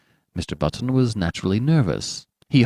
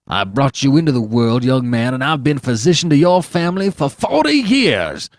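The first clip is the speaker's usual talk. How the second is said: loud, high angry tirade; old doctor storms off at the father; cutting; 4 semitones higher, 4 dB louder than usual. Acted. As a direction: dress him down coldly. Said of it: "answered the doctor cuttingly".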